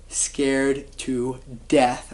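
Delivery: slow, drawn-out, hushed and scared; hushed fear. Retold or imitated. Recalling the terror before approaching the stranger, slow and hushed. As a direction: stretch it out like you're still frightened.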